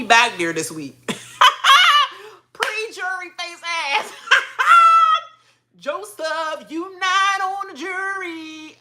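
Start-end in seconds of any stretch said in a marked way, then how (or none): 1.0-2.3 high cackling laugh; shrieking laugh. Real, not acted. Laughing at Joseph's eviction, shrieking. 4.2-5.2 high cackling laugh; shrieking laugh. Real, not acted. Laughing at Joseph's eviction, shrieking. 5.8-8.8 high, slow sing-song taunt; mocking taunt. Taunting Joseph about missing jury, sing-song.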